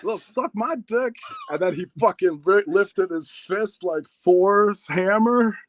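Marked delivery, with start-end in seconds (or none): 0.0-1.2 high, stretched-out cocky insults; mock defiant taunt. Teen goading bigger rival in hallway, mock defiance. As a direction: egg him on in a high, drawn-out voice. Retold taunt before the fight.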